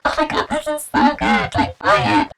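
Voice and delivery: high, loud mock influencer gush. Influencer pushing a product on followers, mock gushing. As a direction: oversell it like a hammed-up influencer. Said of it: "one of these influencers that sits here".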